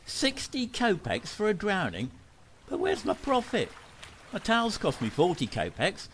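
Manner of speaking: hurt haggler, self-pitying; wounded complaint. Acted. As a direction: plead poverty pitifully. Drowner complains about the price.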